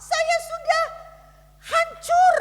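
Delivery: a lightly voiced broken friend, pitched high; despairing friend. Friend protesting to the pastor, lightly voiced high complaint. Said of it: "Dia katakan".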